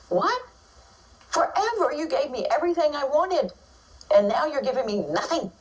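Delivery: put-on high, whiny outraged toddler. Teacher voicing a toddler's outrage, high and whiny. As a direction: squeak out a toddler's betrayed protest. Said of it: "It's like".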